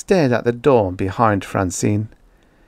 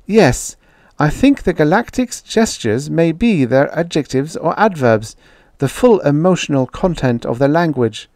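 higher-pitched, eager explaining voice; high, keen scientist making her case; earnest woman researcher; 7 semitones higher, 4 dB louder than usual. Acted. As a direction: put your case to sceptics.

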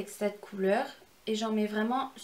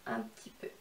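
unvoiced whisper, much lower and softer; whispered trail-off; 11 semitones lower, 11 dB quieter than usual. Real, not acted. Whispering to viewers as the orange loses strength. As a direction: let "un petit peu" fade to a whisper. Trailing comment during application.

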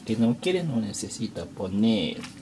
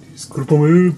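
a louder, earnest "Discúlpame"; louder apology; 4 semitones higher, 9 dB louder than usual. Real, not acted. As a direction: say "Discúlpame" louder, with a rising end. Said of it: "Nunca aprendo español".

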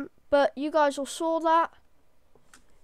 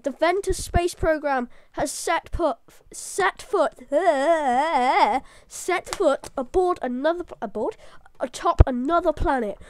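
overly hyper; 3 dB louder than usual. Real, not acted. Gamer announcing the moon landing to viewers, overly hyper. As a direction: proclaim the triumph, carried away with excitement. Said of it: "I'm getting too hyper about this".

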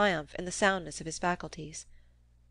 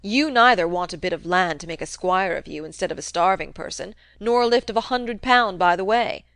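put-on a crusty old man needling his nephew, loud; gruff old uncle; 5 dB louder than usual. Old uncle goading nephew Fred about the rumour, crusty taunt. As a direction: jab at him like a sly old miser.

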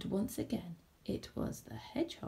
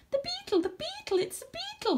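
very high, excited hedgehog squeak. A narrator's reading. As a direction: squeak it out at the top of your voice range. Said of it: "he squeaked".

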